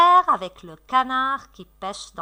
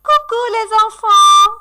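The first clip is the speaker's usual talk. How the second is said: bright call-out; 10 semitones higher, 8 dB louder than usual. Real, not acted. Teacher calling children back from rest, excited.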